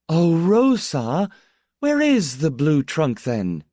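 high, drawn-out call that falls at the end; calling downstairs. A narrator's reading. Baroness calling the maid down the attic stairs, swooping. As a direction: sing out to someone a floor below. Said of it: "voice dipped like a swallow down the attic stairs".

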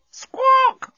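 very high, loud bird squawk; parrot screech. A narrator's reading. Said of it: "said the thing that was squawking".

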